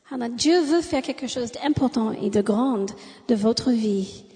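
usual speaking voice

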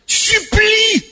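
high, ringing call; a sudden high pleading command; commanding cry. Acted. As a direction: cry it out to the whole room. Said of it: "preacher shouting the command".

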